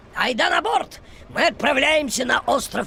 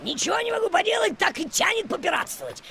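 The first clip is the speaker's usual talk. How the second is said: pirate voice; 6 semitones higher than usual. Acted. Ferry captain slipping into a mock pirate voice. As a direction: growl it out like an old sea dog. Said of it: "У меня и голос соответствующий".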